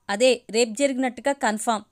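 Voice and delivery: plain reading voice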